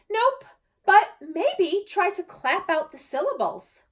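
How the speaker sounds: put-on a cheerful little girl offering advice; helpful girl Emma.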